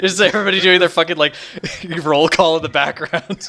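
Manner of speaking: loud, high, laughing disbelief. Real, not acted. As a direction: ask it through real laughter. Amused at players' simultaneous introductions.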